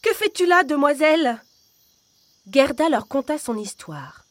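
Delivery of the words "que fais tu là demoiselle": high, loud startled shout; jolted awake and crying out. Acted. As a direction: prince and princess crying out at the intruder. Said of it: "s'écrièrent".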